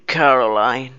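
light imitation of a Texas accent on the name. Quoting the game character's favourite line, in a Texas accent. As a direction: drawl the name out Texas-style. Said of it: "لهجة تكساس كذا".

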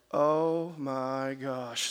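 very slow, low, drawn-out dismay; mock dread. Boy told he must see manager, mock dread. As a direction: let the dread sink in, stretched out and low.

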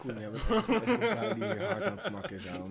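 laughing while talking; words squeezed out through laughter. Real, not acted. Laughing at friend's smacking.

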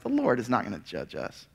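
lightly voiced scoffing complacent crowd; mock dismissive shrug. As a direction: toss it away like it doesn't matter. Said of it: "Their attitude is just like".

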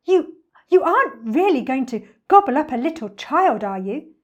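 high, loud, faltering alarm; aghast storybook monkey; frightened monkey. A narrator's reading. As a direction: ask in horrified disbelief. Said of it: "went pale and began to shake".

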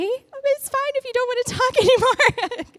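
high, squeaky mock-nervous voice; comic timid retreat, ending loud in a laugh; nervous backing off. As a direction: back out of it in a high little voice. Acts backing off from tough lady.

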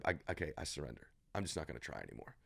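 soft, giving-up sigh of a voice; a person giving up, voiced quietly and resigned; mock surrender. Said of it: "they put their hands up".

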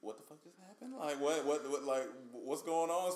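high, shocked "what the fuck"; reliving the panic of seeing the snake; panicked disbelief. Retold or imitated.

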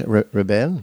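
rebel said the French way, stress on bel; a teasing French take on rebel; mock French rebel. Mocking his French rebel. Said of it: "is that more French?".

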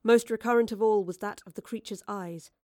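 plain reading voice

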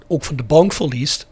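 ordinary talk, nothing marked

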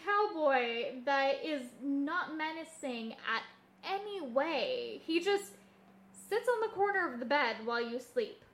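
normal talking voice

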